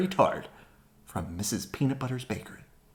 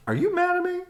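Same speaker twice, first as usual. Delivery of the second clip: much higher and louder anxious question; 5 dB louder, faster than usual. Acted. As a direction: call it out urgently. Asking again, loudly.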